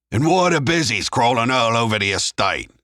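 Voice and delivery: loud, high-pitched angry bark; barked demand. A narrator's reading. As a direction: bark it down the phone line. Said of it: "Sid's voice barked down the line".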